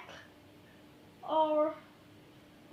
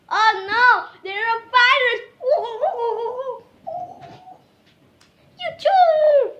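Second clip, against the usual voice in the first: panicked yelp; 9 semitones higher, 14 dB louder than usual. Real, not acted. Panics at virus.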